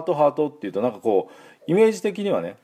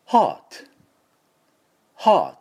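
British "heart" with no R, higher and louder; British pronunciation; 8 semitones higher, 4 dB louder than usual. Shown as a demonstration. Teacher leading repeat-after-me drill, British pronunciation. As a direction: drop the R, just "haa" and t, British style. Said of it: "イギリスの音でもいきますか".

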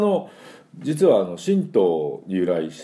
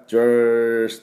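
jock greeting; 4 semitones higher, 4 dB louder, much slower than usual. Pretend. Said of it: "あ、先輩だ".

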